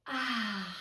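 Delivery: soft, low breathed-out "ah"; calming exhale. Shown as a demonstration. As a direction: let the breath go gently. Models breathing out in story.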